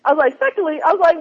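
ordinary talk, nothing marked

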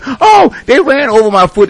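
high, loud imitation of a complainer; mock outraged yell. Radio host mimicking the woman who claimed an injured foot, mock yelling. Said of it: "started yelling, screaming, and cussing".

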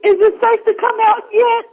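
high, hushed horror-film fright; mock scared. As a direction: play the scared victim in hiding.

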